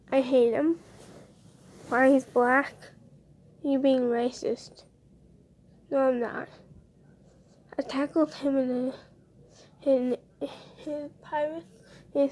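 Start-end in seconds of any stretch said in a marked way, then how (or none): none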